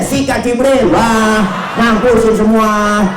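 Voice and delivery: high, loud comic urging; hammed-up shout egging the angel on; gleeful war cry. Tile telling Jibril to wipe them out, eagerly shouting.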